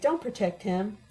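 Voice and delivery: plain reading voice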